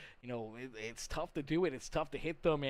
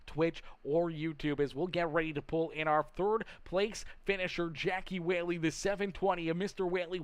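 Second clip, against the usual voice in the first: upbeat, louder broadcaster pitch to viewers; livestream announcer; 4 semitones higher, 6 dB louder than usual. Real, not acted. Race broadcaster pushing likes and follows, upbeat hype. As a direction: hype the audience like a race-night host.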